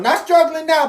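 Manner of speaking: ordinary talk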